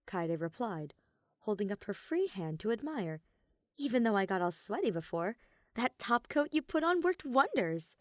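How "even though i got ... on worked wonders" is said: Kaede's voice. Acted.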